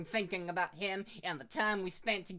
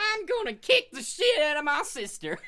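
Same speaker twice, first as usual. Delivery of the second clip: mock hillbilly shout; 8 semitones higher, 8 dB louder than usual. Joins the hillbilly bit laughing.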